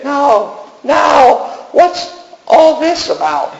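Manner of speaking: gentle heavenly voice. Acted. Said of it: "With a gentle voice behind me said".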